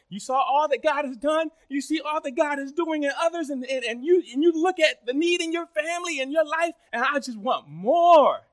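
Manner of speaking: loud, high, driving sermon; preaching at full force. Real, not acted.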